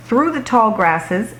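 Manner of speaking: ordinary delivery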